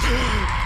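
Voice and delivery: sharp frightened gasp, shouted; jolted gasp at a jumpscare; loud startled gasp. Real, not acted. Jumpscare in horror game.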